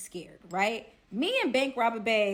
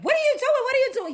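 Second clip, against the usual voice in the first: high, loud imitation of a yell; squawked mock confrontation; mock angry yelling; 9 dB louder, faster than usual. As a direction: fire it out in a high, outraged voice. Acted quarrel, girl confronting man.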